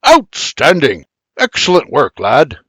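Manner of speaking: giddy Scottish praise. Acted. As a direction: gush with delight in a brogue. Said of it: "the old Scot says giddily".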